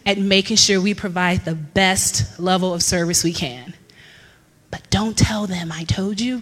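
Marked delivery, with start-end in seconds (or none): 4.7-6.4 hushed, half-whispered aside; confidential whisper. Pretend. Employee to audience about the company, confidential whisper. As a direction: drop the voice as if sharing a secret.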